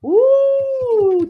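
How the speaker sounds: a loud high drawn-out ooh; delighted ooh. Real, not acted. Reader greets viewer Delene with a loud high ooh. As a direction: coo over the new arrival.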